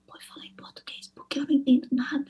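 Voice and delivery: hushed whisper so no one hears. Retold or imitated. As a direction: whisper it secretly to a friend at school. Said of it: "Eu falava bem baixinho pra ela".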